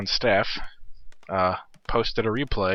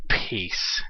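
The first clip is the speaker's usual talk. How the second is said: whispered mock peace; 2 semitones lower than usual. Mocking Freak's catchphrase again.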